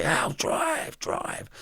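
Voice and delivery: hissed, breathless plea to drive; a tense whispered order to the driver; urgent whisper. Retold or imitated. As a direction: whisper it fast, don't get heard. Urging driver to flee, arrested.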